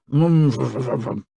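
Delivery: garbled mumble through a mouthful; mouth full, chewing. A narrator's reading. Crocodile answering while chewing the king, garbled. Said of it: "because he was chewing".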